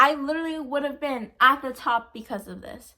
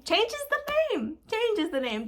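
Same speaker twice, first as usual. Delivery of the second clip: mock squeaky voice; 9 semitones higher, slower than usual. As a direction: jump way up high and slow for changes the name. Mocking the copier's act.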